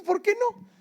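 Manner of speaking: high, loud protesting question; indignant surprise. Retold or imitated. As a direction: shoot the pitch up in disbelief. Arguing with airline clerk.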